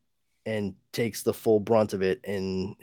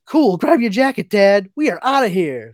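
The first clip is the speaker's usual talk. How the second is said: put-on much higher, louder eager teen; excited kid; 9 semitones higher, 9 dB louder than usual. Bart urges his dad to come along, excited teen voice. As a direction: bounce with eagerness to go.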